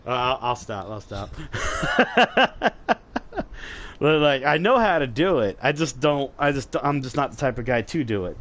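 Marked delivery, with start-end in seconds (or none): 1.4-3.9 breathy high-pitched giggle; embarrassed high laugh. Real, not acted. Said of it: "that was funny".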